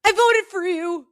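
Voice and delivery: high, loud starstruck blurt; squeaky starstruck "I voted for you"; blurted fan line. Retold or imitated. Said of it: "but I just go".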